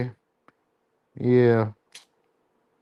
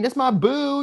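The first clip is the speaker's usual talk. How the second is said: mock bragging lover; 9 semitones higher, slower than usual. Mimicking a show-off partner.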